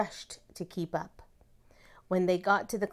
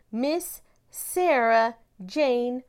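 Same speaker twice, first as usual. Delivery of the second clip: slow, louder, drawn-out announcement of the name; grand introduction; 4 semitones higher, 6 dB louder, much slower than usual. A narrator's reading. Principal introduces the new teacher to the class, slow and grand. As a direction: savor every part of the name like a reveal.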